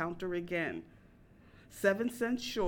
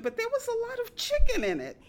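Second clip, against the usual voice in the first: chicken pushed much higher and louder; stress on chicken; 14 semitones higher, 6 dB louder, faster than usual. Retold or imitated. Customer complaining to waitress, hitting "chicken" high. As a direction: lean hard into "chicken", high and loud.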